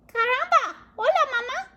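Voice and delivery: very high tiny chick greeting; baby chick voice. Acted. Baby chick to Jack, very high tiny greeting. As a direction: squeak it like a newborn.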